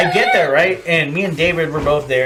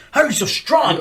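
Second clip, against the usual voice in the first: mock amazement; 9 semitones higher than usual. Amazed at strong cook.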